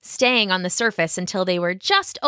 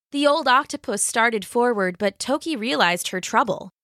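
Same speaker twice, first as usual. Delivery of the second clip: ordinary delivery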